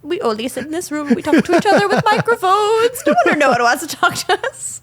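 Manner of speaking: high, loud, hammed-up misery; mock wailing. Podcast host jokes that they only have each other, mock wailing. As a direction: build into a pretend wail of self-pity. Hosts joke about being isolated.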